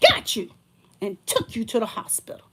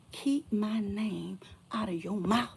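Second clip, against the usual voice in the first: slow, soft, breathy warning; a slow menacing whisper; whispered threat; 6 dB quieter, slower than usual. Real, not acted. Warning the ex to stop talking, slow whisper.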